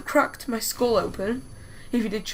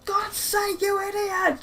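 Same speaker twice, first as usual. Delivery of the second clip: very high, wailing mock yell at usual volume; mock shouting; 9 semitones higher, slower than usual. Hurt kid shouting at his brother, mock high-pitched yell. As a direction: imitate an upset kid yelling in a high voice. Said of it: "Just, like, shouting at him".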